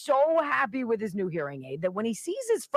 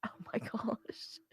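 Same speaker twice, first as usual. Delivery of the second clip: whispered groan; 14 dB quieter, slower than usual. Real, not acted. Reaction to bad joke.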